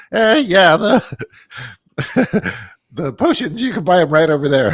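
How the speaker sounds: high nerdy shopkeeper; nerd voice. Retold or imitated. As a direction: squeak it like a shy nerd. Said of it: "stereotypical nerd voice".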